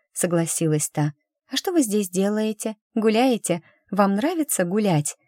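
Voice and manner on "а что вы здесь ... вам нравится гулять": put-on higher, lilting girlish voice; the eager young lady, pitched up; sweet girl voice. Milasha voiced high. (наивная девушка, высокий голос)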